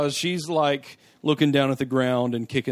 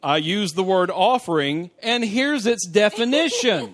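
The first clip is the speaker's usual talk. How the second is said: higher, louder, laughing mock; mock Zor; 6 semitones higher, 5 dB louder than usual. Mocking Zor's clumsy line while laughing. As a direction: parody a stiff smug villain. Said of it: "And I'm like".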